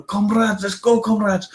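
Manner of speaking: put-on high, hammy Russian accent; rally the comrades in a put-on Russian accent; playful Russian accent call to arms; mock Russian accent. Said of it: "We talk like the".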